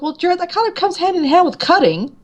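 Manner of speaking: high, sassy retort; mock-obvious answer; sassy reply. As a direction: talk back cheekily. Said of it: "I'm like".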